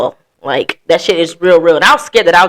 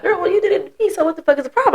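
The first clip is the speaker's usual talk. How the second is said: high-pitched mock indignation; indignant retort; 11 semitones higher, 5 dB quieter, faster than usual. Cheater arguing back after getting even, indignant retort. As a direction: whine back like it's perfectly fair.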